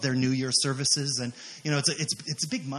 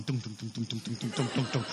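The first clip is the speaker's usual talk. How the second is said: low, soft, rapid thudding; hushed, even heartbeat noise; imitated pounding heartbeat; 5 semitones lower, 6 dB quieter than usual. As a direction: thump it out quietly like a racing heart. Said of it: "my heart was going".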